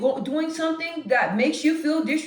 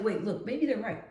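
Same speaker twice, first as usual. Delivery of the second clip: doubtful inner voice; 8 dB quieter than usual. Retold or imitated. Retold self-doubt inner voice.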